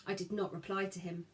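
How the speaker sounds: ordinary delivery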